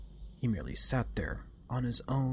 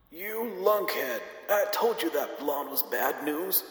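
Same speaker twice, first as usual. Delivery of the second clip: put-on high, slow old man yelling; screaming grandpa; 8 semitones higher, 4 dB louder, slower than usual. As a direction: yell it like a cranky grandpa. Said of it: "Grandpa Theo's voice screaming".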